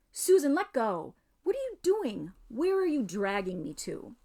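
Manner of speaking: alarmed. Acted. Peter being dragged by magic.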